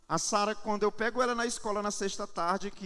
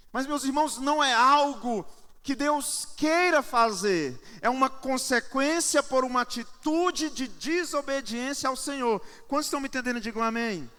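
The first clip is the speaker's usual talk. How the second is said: emphatic, raised preaching voice; 5 semitones higher, 5 dB louder than usual. Real, not acted. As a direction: drive the point home to the congregation. Preaches to congregation. (pregação enfática)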